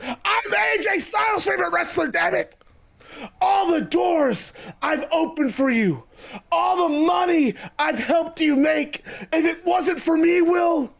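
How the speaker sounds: high, boastful wrestler rant; Jericho, indignant. Acted. Wrestler reminds rival what he owes him, high indignant rant. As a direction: list your favours in high-pitched outrage.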